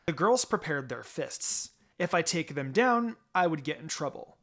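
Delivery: ordinary talk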